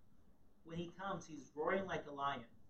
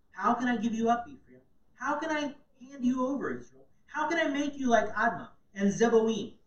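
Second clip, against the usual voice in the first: God's plea, higher and louder; anguished plea; 6 semitones higher, 10 dB louder than usual. Acted. Preacher reads God pleading with Israel, loud. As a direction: cry out the plea.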